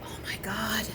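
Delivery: hushed mock-horrified whisper; acted whisper. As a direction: whisper it like a secret. Troupe girl's secret confession, acted.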